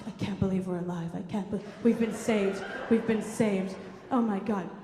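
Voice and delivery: low, soft murmured relief; dazed relieved mother. Retold or imitated. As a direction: utter thanks, spent. Exhausted mother after rescue.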